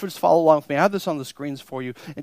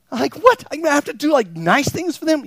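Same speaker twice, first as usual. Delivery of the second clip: much higher, incredulous squeak; mock disbelief; 11 semitones higher, 5 dB louder than usual. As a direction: act shocked at the very idea. Voices incredulous listener.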